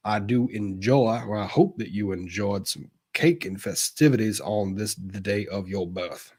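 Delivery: drawling, courtly Southern gentleman; put-on genteel Southern accent with lilting emphasis. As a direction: deliver a flowery toast like an old-time Southern gentleman. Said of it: "Can you say happy birthday in your Southern gentleman accent?".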